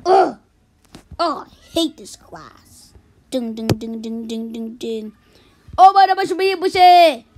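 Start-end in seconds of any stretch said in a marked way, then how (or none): 3.3-5.1 low soft ding chant; low chanted bell dings; bell imitation.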